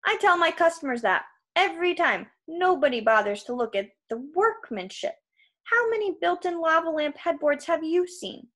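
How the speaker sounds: high, eager salesman patter; flattered salesman, boastful. Acted. Krusty bragging of workmanship to a customer, eager salesman. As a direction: boast about your product, delighted. Voices giant waterbed salesman.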